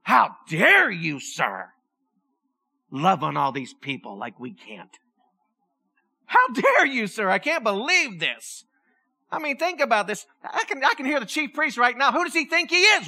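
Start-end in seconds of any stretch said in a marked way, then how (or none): 0.0-8.6 indignant priests. Retold or imitated. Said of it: "They became indignant".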